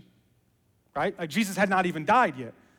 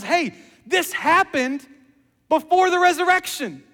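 eager realization; 11 semitones higher, 7 dB louder than usual. Acted. John's sudden realization.

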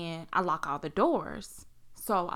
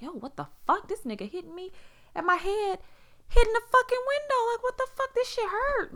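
very high, squealed shock and pain; high-pitched, distressed protest; retold pained outcry; 5 dB louder than usual. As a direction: act out crying out while being hit. Retold beating, reacting in pain.

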